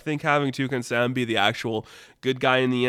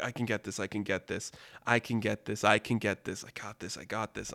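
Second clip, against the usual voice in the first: much softer, gasped mantra; strained self-reassurance; 3 semitones lower, 8 dB quieter than usual. Retold or imitated. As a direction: mutter it to yourself through the pain. Wounded Sam digging out loop.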